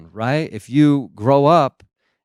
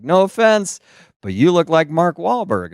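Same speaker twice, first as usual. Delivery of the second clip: stranger voice; 7 semitones higher than usual. Retold or imitated.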